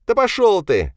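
high, loud upset cry, lightly sobbing; tearful outburst. A narrator's reading. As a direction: lash out on the edge of tears. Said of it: "всхлипнул Павел". (обиженный выкрик со всхлипом)

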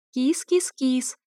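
slow, high coaxing kitty call; calling a cat. A narrator's reading. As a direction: lure the cat, drawn out. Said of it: "я звала". (ласковый зов кошки, медленно)

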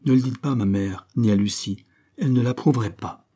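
usual speaking voice

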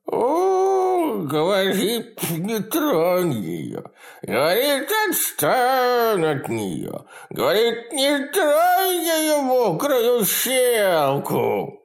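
very high, slow mocking shriek; shrieking mocking creature. A narrator's reading. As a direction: mimic him in a shrill wail. Creature mocks him shrieking.

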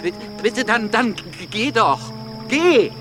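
stammering, rising to a cry; hurt, angry dismissal. Acted. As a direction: send him away, building to a high shout. Camille tells Georges to leave.

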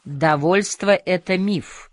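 ordinary delivery, nothing marked

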